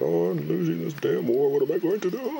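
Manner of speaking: hammed-up fretting president; worried Lincoln. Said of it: "He's like".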